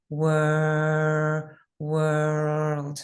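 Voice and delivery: "Wor-" held long then "world" finished; stretched vowel. Shown as a demonstration. Teacher shows students how to practise "world" slowly. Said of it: "stay on it for a while".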